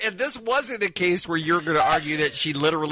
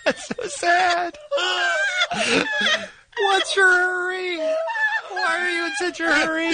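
high, drawn-out wail through laughter; mock lament, cracking up; 14 semitones higher, slower than usual. Real, not acted. Lamenting the guest's cut-off, laughing.

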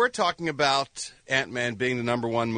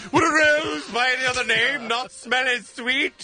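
Shakespearean bard; 10 semitones higher than usual. Acted. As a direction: boom it out like a stage bard. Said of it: "Being the bard".

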